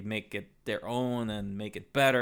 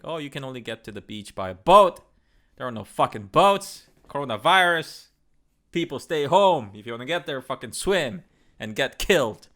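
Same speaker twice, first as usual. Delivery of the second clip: mock rant; 8 dB louder than usual. Ranting at the closed beach.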